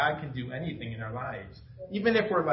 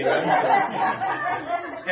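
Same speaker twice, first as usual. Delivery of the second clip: laughing; 13 semitones higher, 5 dB louder, much slower than usual. Retold or imitated. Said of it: "And she's like".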